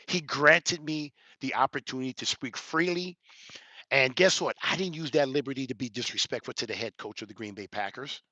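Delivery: ordinary delivery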